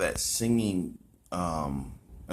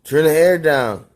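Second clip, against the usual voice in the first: loud, whiny complaint about heat; 8 semitones higher, 11 dB louder, slower than usual. Real, not acted. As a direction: complain loudly it's too hot.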